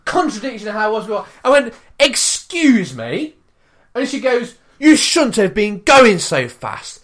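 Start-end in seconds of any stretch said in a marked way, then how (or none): 2.0-3.3 loud, high, stretched-out disbelief; incredulous, indignant query; affronted British politeness. Retold or imitated. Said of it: "me being British". 4.8-7.0 loud, slow, drawn-out reprimand; indignant raised-voice telling-off; retold scolding. Woman's retort acted out again.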